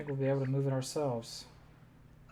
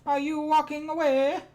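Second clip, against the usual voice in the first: loud, high, drawn-out cartoon pleading; Luigi's voice; 10 semitones higher, 7 dB louder, slower than usual. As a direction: wail up high to someone leaving you behind. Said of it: "That's it".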